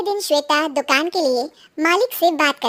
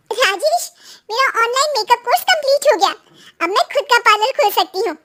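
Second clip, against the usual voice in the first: higher-pitched, quick burst of good news; excited wife; 7 semitones higher than usual. Acted. Wife tells husband her course is complete, excited. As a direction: eagerly share the news, voice lifted.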